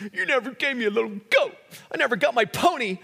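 high, fast mocking whine; mock the spoiled son's petty complaint; whiny elder son. Preacher mocking the elder son's whining to his father, high whiny voice.

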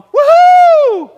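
high, loud whooping cheer; excited whoop. Retold or imitated. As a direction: whoop with joy. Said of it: "it's more like Adam going".

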